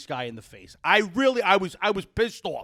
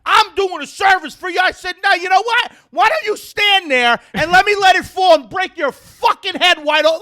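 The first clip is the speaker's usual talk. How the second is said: high, loud retold tirade; pissed-off shouting; 11 semitones higher, 6 dB louder than usual. Tree owner berates cyclist on his property, furious retold shout. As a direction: relive it as a full-volume threat. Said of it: "I was so pissed".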